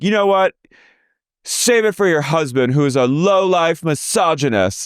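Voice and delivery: high parody of an insult; imitating Lisa. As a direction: snap back at her. Mimicking Lisa.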